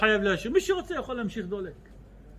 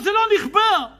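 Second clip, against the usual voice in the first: panicked worker; 11 semitones higher, 8 dB louder than usual. Acted.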